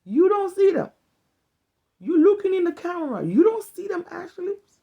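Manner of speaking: high, loud scolding grandma voice; granny telling off her granddaughter, loud and high; African grandmother scolding. Retold or imitated. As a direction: nag like a grandmother fussing over ashy lips. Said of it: "I could hear my African grandmother".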